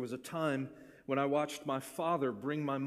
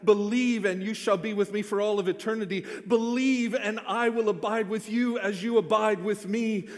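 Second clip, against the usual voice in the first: louder and higher, building, repeated refrains; full-force preaching; 6 semitones higher, 7 dB louder than usual. Acted. Pastor urges the congregation to believe, fervent preaching. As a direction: preach it to the back row.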